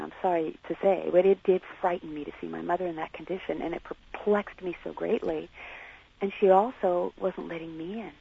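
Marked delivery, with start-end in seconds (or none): none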